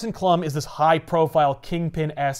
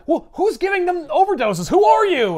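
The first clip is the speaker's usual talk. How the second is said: high, louder urgent demands; agitated questioning; 12 semitones higher, 4 dB louder than usual. Retold or imitated. Spider-Man on the phone demanding answers, agitated. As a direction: press the caller for answers. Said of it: "And he's like".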